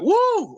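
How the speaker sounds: high whooping crowd cheer; imitated crowd whoop. Explaining licks that get a crowd reaction, high imitated whoop. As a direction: whoop like a thrilled audience. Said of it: "crowd reaction".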